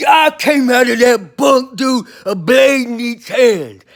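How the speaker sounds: loud, slow and furious, storming out of the bunk; angry outburst. Retold or imitated. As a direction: bark it out like you're jumping down with a blade in each hand. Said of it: "I had to control my anger".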